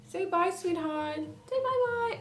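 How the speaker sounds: very high, slow cooing to a kitten. Real, not acted. Baby talk to kitten.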